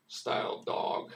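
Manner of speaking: ordinary talk, nothing marked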